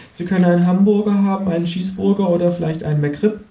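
put-on high young cashier offering options; teenage cashier voice. Cashier offers alternatives to the kangaroo, high voice. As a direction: reel off the menu like a boy at the till. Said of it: "sagt der sechzehnjährige mit der Kappe".

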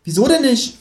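high-pitched kangaroo question; expectant kangaroo. Acted. Kangaroo waits for the narrator's oath, high prodding creature voice. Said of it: "fragt das Känguru".

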